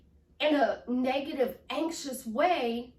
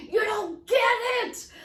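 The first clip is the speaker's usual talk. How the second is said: high, loud exasperated outburst; frustrated shout; 13 semitones higher, 8 dB louder than usual. Acted. Coach venting about clients into speech-to-text, frustrated. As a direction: yell it in sheer exasperation. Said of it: "and I'm frustrated".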